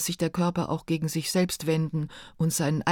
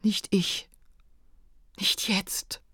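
hushed, understated sob, fading to a whisper; tearful half-whispered plea; narrated sobbing; much slower than usual. A narrator's reading. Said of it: "schluchzte ich".

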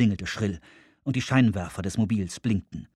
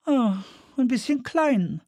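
hohe, langsame Frauenstimme; Frauenfigur; 13 semitones higher than usual. Acted. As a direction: zieh es hoch und langsam als Frau. Woman voiced in narration. (hohe, gedehnte Frauenstimme)

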